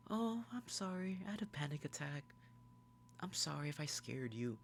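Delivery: high, soft apologetic imitation; small, high put-on girl's voice; Nikki. Nikki apologizes for scaring Bear, high soft imitation.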